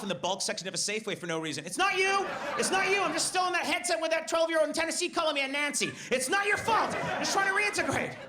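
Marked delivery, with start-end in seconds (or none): 1.6-8.2 higher, pleading over-the-top; frantic gamer apology. Retold or imitated. Gamer apologising to shoppers after snapping at them. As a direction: beg forgiveness like a shell-shocked gamer.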